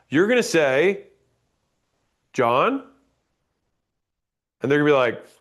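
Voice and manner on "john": "John?" with a rising question tune, then pause; "John?" asked up, like calling someone you know; first name as question. Shown as a demonstration. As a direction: say just "John?" lifting at the end and stop. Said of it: "You just say first name, question mark, pause".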